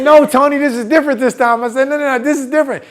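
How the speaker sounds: high, urgent mock protest; insistent pleading. Student begging buddy Tony for her schedule, insistent. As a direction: plead your case, high and pressing.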